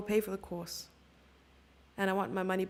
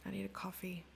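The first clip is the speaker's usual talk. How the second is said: whisper; 7 dB quieter than usual. Real, not acted. Tired aside, whispered.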